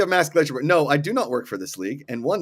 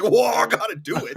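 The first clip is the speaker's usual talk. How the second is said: excited; 7 semitones higher than usual. Retold or imitated. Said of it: "and I was like".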